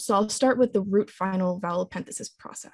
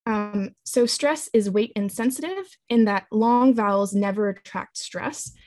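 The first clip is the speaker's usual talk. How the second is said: ordinary talk, nothing marked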